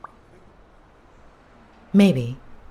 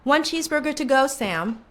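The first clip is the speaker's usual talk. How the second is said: calling out order; 10 semitones higher than usual. Acted.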